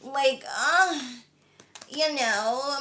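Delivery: lightly mocked American accent; a hint of whiny, drawn-out American voice; Kardashians. Shown as a demonstration. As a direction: parody an American reality star telling a story. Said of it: "how people can watch the Kardashians".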